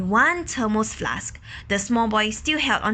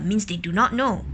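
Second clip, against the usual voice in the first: ordinary delivery, nothing marked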